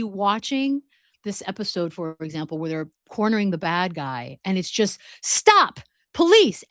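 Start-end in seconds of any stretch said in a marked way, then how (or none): 5.2-6.6 high, loud call of stop police; a quick hammed-up police yell; mock police shout. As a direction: bark it like a bobby chasing a suspect. Police shouting at fleeing suspect.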